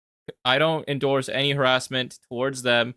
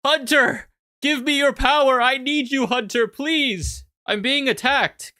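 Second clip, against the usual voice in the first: high, desperate begging for help; pleading Ryan impression; 10 semitones higher, slower than usual. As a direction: beg Hunter like a cornered ally. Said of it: "I'm depicting him as".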